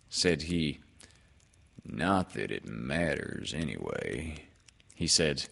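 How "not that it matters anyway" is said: low, resigned donkey voice; dejected and dreary; Eeyore. A narrator's reading. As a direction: give up glumly, pitched well down.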